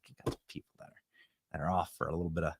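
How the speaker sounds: conspiratorial whisper, voice dropping away; whispered aside. Real, not acted. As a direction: lean in and whisper it like a secret. Hushed aside about sick staff.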